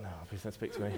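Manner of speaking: quiet, laughing mock plea; half-laughing, playfully begging off. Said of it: "they were like".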